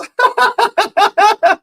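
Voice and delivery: high, loud cackling laugh. Real, not acted. Streamer reading a friend's text, loud laugh. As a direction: throw the head back laughing.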